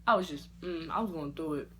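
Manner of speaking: usual speaking voice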